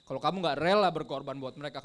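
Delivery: "rela" punched out loud; forceful stress on "rela"; emphatic repeat. Retold or imitated. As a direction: hit "rela" hard, then ease off. Repeats father's saying with emphasis.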